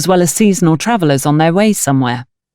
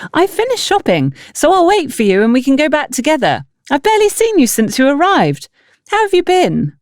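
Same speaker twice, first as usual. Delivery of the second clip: high, bubbly village woman, pleasantly surprised; cheerful sister-in-law; 9 semitones higher than usual. Acted. Bridget greets Antonia at the market, high and delighted. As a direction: voice Bridget bright and high, delighted to see her.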